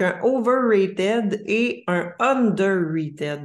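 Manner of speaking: overrated and underrated drawn out slowly with an English accent. Real, not acted. Host announcing the episode theme, words in an English accent. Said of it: "désolée pour mon accent anglais".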